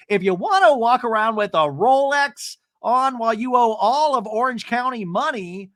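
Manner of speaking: a hammed-up jab; mock angry Emily. Podcaster imitates Emily attacking Jen over the Rolex. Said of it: "Emily keeps going".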